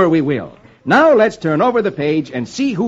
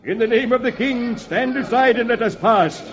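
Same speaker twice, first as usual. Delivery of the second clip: higher-pitched formal command; commanding Archbishop; 4 semitones higher than usual. Acted. Archbishop to the sheriff's men, high commanding call. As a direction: order them aside in the King's name.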